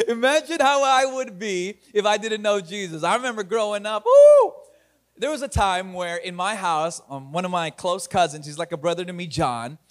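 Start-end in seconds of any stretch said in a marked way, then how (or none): 4.0-4.5 high, loud excited "woo"; a sudden whoop of excitement. Real, not acted. Excited whoop mid-story.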